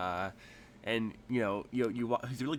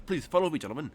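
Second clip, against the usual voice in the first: lightly put-on Cockney shopkeeper; mild Cockney host; Cockney-tinged tailor; 5 semitones higher, 5 dB louder, faster than usual. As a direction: usher them along with a hint of Cockney. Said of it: "he's really Cockney instead of".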